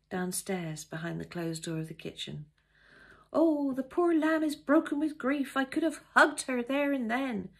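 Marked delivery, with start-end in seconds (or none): 3.3-7.5 high, loud sympathetic maid; gushing maid Dora. Pretend. Maid gossiping about the guest, high and gushing. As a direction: pour out pity for the poor lamb. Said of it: "How would Dora describe me".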